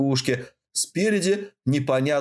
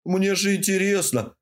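pleading; 7 semitones higher than usual. A narrator's reading. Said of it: "попросил он жалобно".

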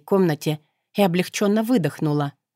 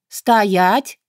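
shouted command; 3 semitones higher, 4 dB louder than usual. A narrator's reading. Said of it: "выкрикнула".

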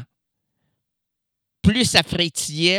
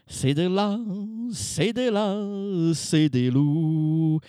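slowed drawl; much slower than usual. Acted. Slowed warped speech.

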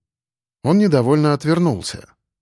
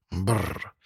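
cold shiver sound; teeth-chattering brr; shivering brrr; 6 semitones lower, 9 dB quieter than usual. Acted. Shivering in frost. (дрожь от холода, бррр)

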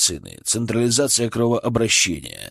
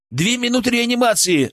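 higher yelled announcement; yelling; 11 semitones higher than usual. A narrator's reading. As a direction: yell the time. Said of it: "орал Петров".

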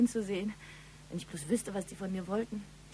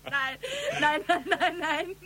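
giggling higher denial; laughing protest; 8 semitones higher, slower than usual. Acted. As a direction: laugh off the compliment.

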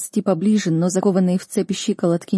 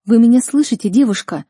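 raised, higher, urgent plea; imploring call; 4 semitones higher, 6 dB louder than usual. A narrator's reading. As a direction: call out, begging for an answer. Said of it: "Я звала её несколько минут, умоляя". (громко и умоляюще зовёт)

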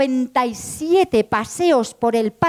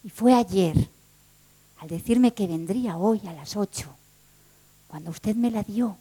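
slower, low and soft, fading; gentle reveal; 5 semitones lower, 4 dB quieter, slower than usual. Acted. Clerk gently telling the woman she never lost it, low and soft. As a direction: tell her tenderly.

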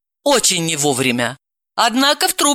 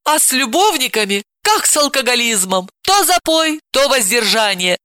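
higher, scolding lecture; 9 semitones higher than usual. Acted. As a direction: set him straight. Aunt lectures nephew.

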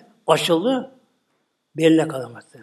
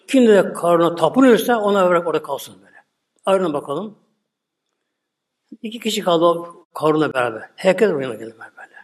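ordinary talk

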